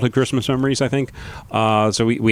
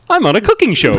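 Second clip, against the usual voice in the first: cooking show host; 7 semitones higher, faster than usual. Shown as a demonstration. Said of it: "a real pleasant".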